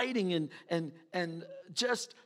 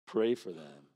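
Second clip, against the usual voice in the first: snide insinuation; 9 semitones lower, slower than usual. Retold or imitated. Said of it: "And you go".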